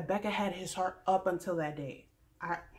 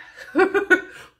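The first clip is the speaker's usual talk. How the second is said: high burst of laughter; loud laugh; 11 semitones higher, 12 dB louder than usual. Real, not acted. After mocking Crystal, loud laughing.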